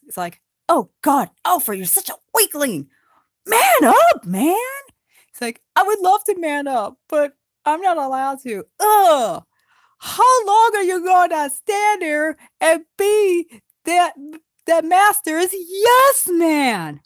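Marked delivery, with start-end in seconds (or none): 0.7-4.5 high, loud scorn; scornful dwarf. Acted. Scolding Alfred as weakling. 5.8-17.0 high, loud whiny complaint; whiny Alfred. Acted. Alfred whining back.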